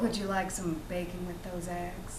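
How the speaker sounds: low, soft smoky noir voice. Shown as a demonstration. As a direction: purr it low, like film noir. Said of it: "let's hear the smoky noir".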